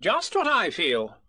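high, bossy little rabbit voice; fussy Rabbit. Acted. Rabbit pressing Piglet to agree, bossy and high. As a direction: lay out the plan like a busy organiser.

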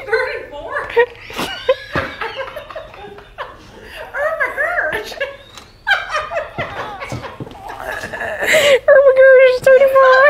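laughing too hard to speak; breaking down in giggles; helpless laughter. Real, not acted. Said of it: "I can't. I'm so sorry".

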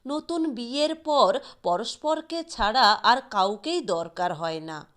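ordinary delivery

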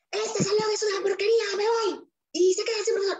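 fed-up teacher walking out; mock teacher. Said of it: "teacher storms out of class".

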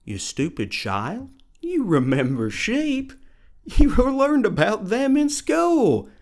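sneering, mock-sweet scolding voice; wicked stepmother. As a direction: talk down to a stupid child. Mock condescending voice scolding a dummy.

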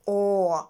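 «О» as a long aw; long O sound. Shown as a demonstration. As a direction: hold the vowel «О». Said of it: "читаються як довгий звук". (довгий звук «О»)

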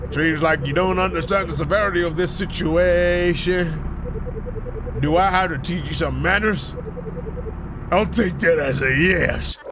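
slow drawn-out villain taunt; gloating villain. Acted. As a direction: savor catching the runaway. Villain confronts number eight.

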